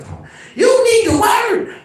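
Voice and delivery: high, loud mock grandma shout at full force; grandma yelling. Grandma scolding her grandson while whacking him. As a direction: bellow it like a furious grandma.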